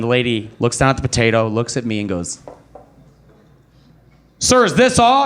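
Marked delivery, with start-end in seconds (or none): 4.4-5.2 high, prim question from the cashier; a lightly voiced prim cashier; mock cashier voice. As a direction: play the puzzled cashier, pitched up. Cashier questions lone potato.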